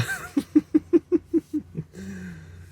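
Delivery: high bright giggle. Real, not acted. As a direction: burst into a giggle. Laughing at own look.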